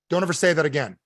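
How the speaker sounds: ordinary talk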